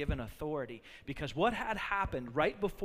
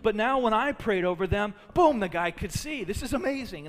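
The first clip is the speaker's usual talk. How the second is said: louder, higher, excited voicing of the disciples; preacher voicing disciples buzzing over a healing; pumped disciples; 5 semitones higher, 6 dB louder than usual. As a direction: play the apostles thrilled at a healing. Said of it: "they're getting pumped".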